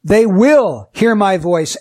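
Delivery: slow, with "will" drawn out; stress on WILL. Shown as a demonstration. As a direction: lean long on "will" to sound certain. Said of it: "emphasizing certain words".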